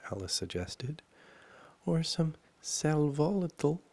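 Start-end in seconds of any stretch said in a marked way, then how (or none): 1.8-3.8 put-on high, loud girl character; Alice's voice. A narrator's reading. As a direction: pitch it up, suggesting as young Alice.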